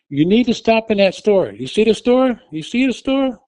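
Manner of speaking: high, loud, rising insistence; urgent calling. Retold or imitated. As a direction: call the store out again and again. Urgently directing dazed driver.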